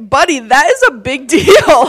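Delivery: loud retold insistence, breaking into laughter; emphatic exclamation. Praying woman to dad on the phone, loud emphatic insistence. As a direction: drive it home loudly, laughing at the end.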